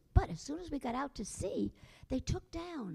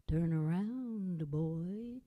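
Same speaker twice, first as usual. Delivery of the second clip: lower, very slow eerie skeleton voice; spooky voice; 7 semitones lower, 4 dB louder, much slower than usual. A narrator's reading. Skeleton speaks from behind Jack, low, slow and eerie. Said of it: "said a voice behind him".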